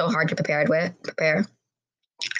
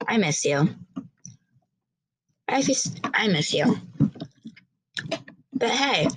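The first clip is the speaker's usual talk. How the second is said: put-on a girl talking to her brother; 4 semitones higher than usual.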